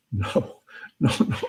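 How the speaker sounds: lightly acted, desperate begging; pleading. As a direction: beg half in earnest, rising on the plea. [name] begs the voice in joke.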